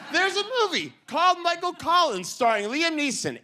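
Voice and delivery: high, pushed-up exasperated ranting; comic outrage. Acted. Comedian rants about the other Collins movie, exasperated. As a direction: climb high as the grievance peaks.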